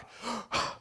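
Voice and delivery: sharp, shocked intake of breath; mock horrified gasp. Preacher imitating the woman recoiling from him, mock gasp. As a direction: gasp as if badly offended. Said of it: "she looked at me like".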